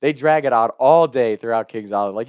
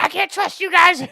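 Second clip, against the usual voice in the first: Lenny; 6 dB louder than usual. Retold or imitated. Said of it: "He was bitching us out".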